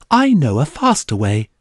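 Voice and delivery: smug brat showing off to the teacher; spoiled child. A narrator's reading. Young Serafina answers her teacher as a spoiled child. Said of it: "in the voice of a spoiled child".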